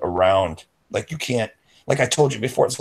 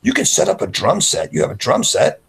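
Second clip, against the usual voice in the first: higher, eager selling voice; higher, upbeat persuading; coaxing pitch; 8 semitones higher than usual. Retold or imitated. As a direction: sell him on the jam session. Coaxing friend with jam sessions.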